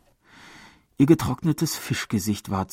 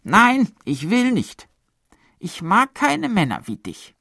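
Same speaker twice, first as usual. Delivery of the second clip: cold refusal; 12 semitones higher, 6 dB louder than usual. A narrator's reading. Woman refusing the pleading man, cold and high. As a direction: push him away coldly. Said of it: "eine raue Stimme".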